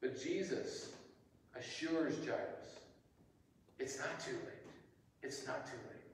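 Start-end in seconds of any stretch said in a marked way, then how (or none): 3.8-6.0 gentle reassurance. A narrator's reading. Jesus assures Jairus gently.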